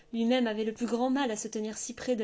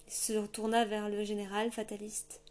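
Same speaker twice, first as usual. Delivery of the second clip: ordinary delivery, nothing marked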